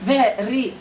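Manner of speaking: VERY as two beats, like tuk-tuk; "very" split into ve and ry; two tapped syllables. Shown as a demonstration. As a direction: tap out "very" in two firm pulses. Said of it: "टक, टक, ऐसे".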